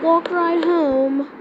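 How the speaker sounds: usual speaking voice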